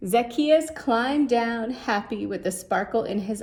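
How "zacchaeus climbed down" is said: usual speaking voice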